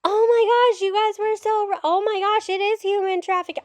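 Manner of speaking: high, sing-song mock amazement; mocking people who just discovered it, in a high voice; mock gushing surprise. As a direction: parody sudden shocked discovery. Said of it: "when something like this comes out".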